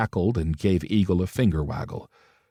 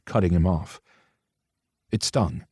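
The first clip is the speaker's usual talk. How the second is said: usual speaking voice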